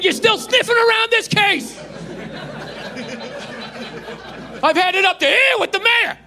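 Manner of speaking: laughing through a mock tirade at the detective; angry police captain. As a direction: play the furious eighties cop captain chewing out his detective. Acting cop movie captain scene.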